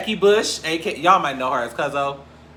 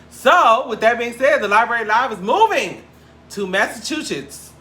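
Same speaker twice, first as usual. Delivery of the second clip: higher and louder, a big reveal; excited announcement; 8 semitones higher, 6 dB louder than usual. Real, not acted. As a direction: announce the move like big news.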